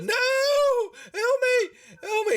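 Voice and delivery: very high falsetto plea; hammed-up panicked shrieking; screaming halfling. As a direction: squeal for help as you flee. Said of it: "she's screaming".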